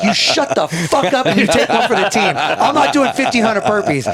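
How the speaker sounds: high-pitched, insistent mock outrage; worked-up retold telling-off; heated order. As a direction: overrule him, indignant and comic. Acted order to reluctant teammate.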